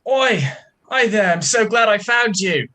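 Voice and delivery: small lost traveler hailing the bandits with delight; cheery greeting. Acted. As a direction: call out like a lost little creature thrilled to see people. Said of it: "and just go".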